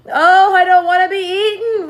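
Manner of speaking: high, loud wail. A narrator's reading. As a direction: wail it out in fear. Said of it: "wailed the earthworm".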